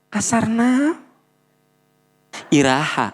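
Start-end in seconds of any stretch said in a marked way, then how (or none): 0.1-1.0 a touch higher, lightly voiced husband brushing it off; defensive husband. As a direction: play the husband shrugging off the complaint. Husband voiced in acted quarrel.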